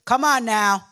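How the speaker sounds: normal talking voice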